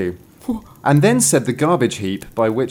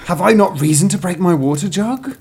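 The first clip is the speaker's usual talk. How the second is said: high, racing storybook recital; frantic indignant girl; 8 semitones higher, 4 dB louder, faster than usual. Acted. Girl answers the spring, frantic high protest. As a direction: pile up the woes, climbing higher. Girl's escalating recital of woes.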